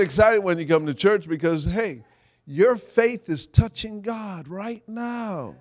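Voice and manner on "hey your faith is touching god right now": ordinary talk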